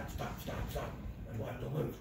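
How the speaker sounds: hushed urgent stop; a breathy warning whisper to freeze. Retold or imitated. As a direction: whisper it sharply so the deer won't hear. Friends halt on spotting buck.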